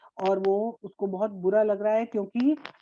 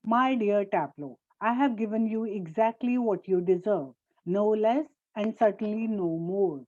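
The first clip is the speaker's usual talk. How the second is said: usual speaking voice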